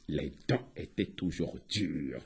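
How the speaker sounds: very low, soft aside; hushed narration. A narrator's reading. Storyteller reveals the failed cooking, low and hushed. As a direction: drop to a low hush.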